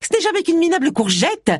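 high, mocking sneer; sneering laugh. A narrator's reading. (ricanement moqueur, voix aiguë)

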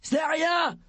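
high shouted reassurance; short high-pitched call. A narrator's reading. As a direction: shout it through a door. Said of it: "fit la voix de Fred".

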